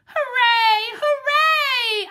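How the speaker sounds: very high, loud overjoyed cheer; joyful bark. Acted. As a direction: explode with doggy delight. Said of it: "I bark".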